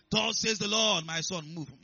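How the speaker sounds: loud, commanding voice of the Lord; booming proclamation that eases off at the end; authoritative. Acted. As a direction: declare it like a divine order. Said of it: "it's even authoritative".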